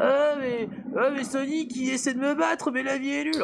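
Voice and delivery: high hammy villain voice; méchant de Sonic. Retold or imitated. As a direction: ham it up like a cartoon baddie. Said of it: "je l'imite très, très mal". (imitation ratée d'un méchant de jeu vidéo)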